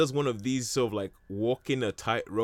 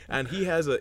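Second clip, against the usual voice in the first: usual speaking voice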